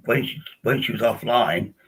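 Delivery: usual speaking voice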